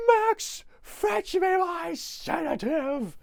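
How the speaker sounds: very high, loud, slow bellow; mock Grinch shout. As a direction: shriek for the dog in despair. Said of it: "And he goes".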